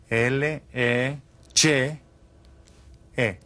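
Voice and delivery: letters L E C E given one by one, slowly; slow spelling out. Shown as a demonstration. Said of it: "Did you write down".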